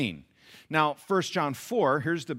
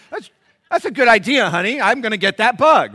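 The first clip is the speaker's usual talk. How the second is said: high, loud sudden enthusiasm; mock eagerness; 8 semitones higher, 13 dB louder than usual. Husband suddenly agrees to kill the bug, mock eagerness. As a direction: agree with comic urgency.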